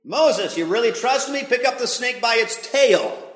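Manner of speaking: God dares Moses to grab the snake, loud and high; challenging God. Acted. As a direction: dare him.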